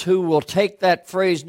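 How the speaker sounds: ordinary talk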